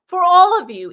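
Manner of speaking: emphatic. Acted. Channelled angels widen the healing to all callers, emphatic and loud.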